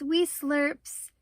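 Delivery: usual speaking voice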